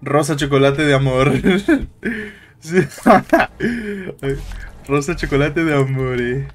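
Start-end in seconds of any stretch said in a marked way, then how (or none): 1.4-2.3 a quick high-pitched giggle. Real, not acted. Se ríe de la palabra clave. 2.8-4.8 longer high-pitched laugh; high laughter. Real, not acted. Sigue riéndose.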